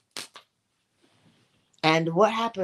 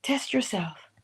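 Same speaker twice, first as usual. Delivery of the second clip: whisper; 4 dB quieter, slower than usual. Real, not acted. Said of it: "whispered repeat".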